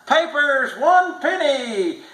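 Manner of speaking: high, slow street hawker call; newsboy cry. A narrator's reading. Newsboys hawking papers on the street, high slow call. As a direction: sing it out like a newsboy selling papers. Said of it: "the newsboys crying".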